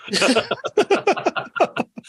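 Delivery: high burst of laughter; real laughter after the joke. Real, not acted. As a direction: crack up at the punchline. Punchline of Muldoon story.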